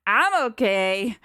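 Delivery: loud, drawn-out call while getting up; shouting. Acted. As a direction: call out that you're fine. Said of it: "Theo shouts as he scrambles".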